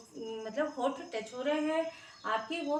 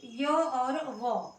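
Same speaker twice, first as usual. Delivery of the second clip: slow emphasis; much slower than usual. Shown as a demonstration. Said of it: "इसको देखिए ध्यान से".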